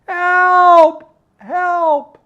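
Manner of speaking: shrill, very high, loud cry for help; screaming for help. Shown as a demonstration. Teacher acting the scared woman's scream for help. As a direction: scream it out in terror.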